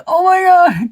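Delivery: high, loud oh my God; excited exclamation. Retold or imitated. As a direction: burst out in excitement. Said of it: "And I'm like".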